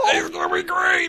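very high, strained cheer; insistent squeal. Real, not acted. Host contradicting the cohost about the accent, squealed. Playful dispute over accent.

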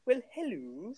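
put-on Welsh accent. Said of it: "Do your Welsh accent again".

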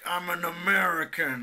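slow, flat generic American drawl; generic American accent. Retold or imitated. Podcaster mocking how British actors play Americans, generic American accent. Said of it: "τα κάνουν όλα flat".

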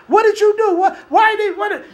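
high, loud, frantic interrogation; shouted questions. Acted. Sailors shouting questions at Jonah, high and frantic. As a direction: bark the questions at him. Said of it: "they started asking questions".

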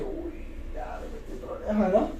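whispered in fear; a hushed uh-oh of a kid in trouble; scared whisper. Retold or imitated. Fearful inner thought.

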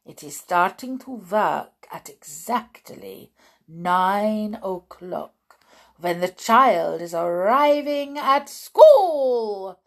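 put-on accented witch voice building to a shout; triumphant shout. Witch revealing when the formula works, triumphant accented shout. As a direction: build to a loud, rising climax. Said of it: "shouted the Grand High Witch triumphantly".

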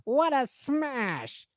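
very high, slow leprechaun pun; drawn-out impish gloating. Retold or imitated. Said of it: "good Leprechaun impression".

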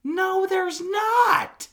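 high, drawn-out shouted denial; outraged shout. Real, not acted. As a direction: shout them down, stretching it out slowly. Outraged denial in sports argument.

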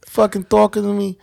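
high hammed-up tough-guy taunt; mock tough guy. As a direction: taunt your reflection like a movie tough. Mock mirror tough talk.

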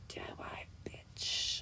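unvoiced, hushed retort; sneering whisper of a comeback; whispered insult. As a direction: hiss it under your breath. Mean retort whispered in retold quote.

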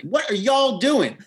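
high, drawn-out Southern scolding, imitated; mock-mad Southern accent. Host acts co-host finding her house ruined, mock-mad Southern drawl. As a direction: play annoyed in a slow, high Southern accent. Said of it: "What if you're mad?".

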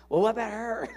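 high, loud comic retort; loud rhetorical comeback; mock indignant. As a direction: fire it back indignantly. Mock reply voicing himself.

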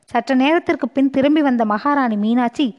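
usual speaking voice

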